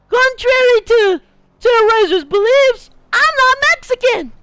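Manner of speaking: high, loud-edged cartoonish voice, switching between English and Spanish; high, exclaiming put-on voice; put-on character voice. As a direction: play a cocky character in a high strained voice. Holds the put-on voice.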